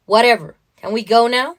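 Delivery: put-on loud, impatient teen brush-off; bratty teenage daughter. Teenage Anna wanting to leave the station, loud and impatient. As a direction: blurt it out like a fed-up teenager.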